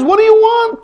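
loud, very high protest; acted rabbi's high-pitched challenge; exasperated retort. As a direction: throw the question back, exasperated.

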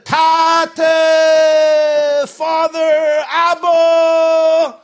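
high, loud anguished cry; desperate scream. Acted. Man burning in purgatory screams to his Rebbe, anguished high shout. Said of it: "screaming like a Breslover knows how to scream".

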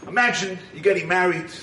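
normal talking voice

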